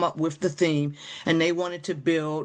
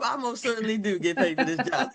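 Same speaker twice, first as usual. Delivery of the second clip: higher-pitched, laughing insistence; retold amused assertion; 5 semitones higher than usual. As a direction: answer emphatically through a laugh. Retold proud answer, laughing.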